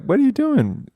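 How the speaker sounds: very high mock disbelief; incredulous question. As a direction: squeak it out like you can't believe it. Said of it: "You know, like".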